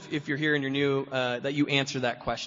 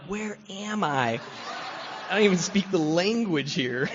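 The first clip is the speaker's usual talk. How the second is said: higher, drawn-out mock bewilderment; bewildered drunk; 5 semitones higher, slower than usual. Drunk waking abroad with no wallet, comically bewildered. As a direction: play lost and confused, stretching the opening question.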